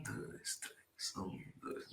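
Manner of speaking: whispered count in three; whispered wrong count. Shown as a demonstration. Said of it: "não dá certo".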